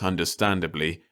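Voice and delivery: ordinary delivery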